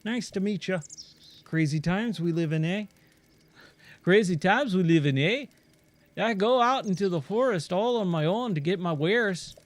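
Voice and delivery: hammed-up mongrel accent for a friendly traveling trader; Giro in muddled accent. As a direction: blend Australian, Irish and Scottish accents, playfully. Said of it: "I have no idea what accent that is".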